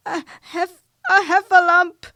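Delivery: out of breath. A narrator's reading. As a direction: gasp the words out after running. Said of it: "breathing so hard that he could hardly speak".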